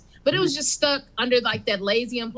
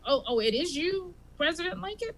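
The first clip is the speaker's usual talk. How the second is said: Bob surprised; 6 semitones higher, 5 dB quieter than usual. Acted. Bob realizing it's Lincoln.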